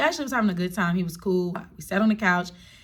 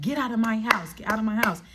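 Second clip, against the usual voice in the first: ordinary talk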